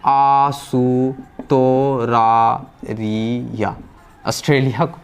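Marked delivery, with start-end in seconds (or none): 0.1-3.8 आसुतोरारिया drawn out very slowly, syllable by syllable; very slow demo of आसुतोरारिया; Japanese pronunciation. Shown as a demonstration. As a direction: stretch each syllable of आसुतोरारिया as a Japanese word. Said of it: "Australia क्या बोलेंगे?".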